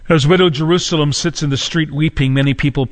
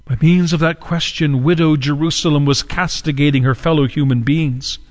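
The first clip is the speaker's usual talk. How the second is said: normal talking voice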